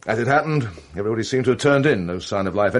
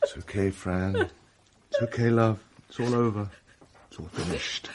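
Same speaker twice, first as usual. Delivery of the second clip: soft comforting; 7 dB quieter than usual. Acted. Comforting distraught Fran.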